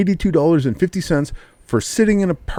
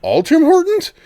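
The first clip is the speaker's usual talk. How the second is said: incredulous. Retold or imitated. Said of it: "The guy goes".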